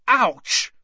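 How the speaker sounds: high, loud cry of pain; pained yelp. A narrator's reading. Boy struck on the head, pained yelp. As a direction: yelp at a sudden knock.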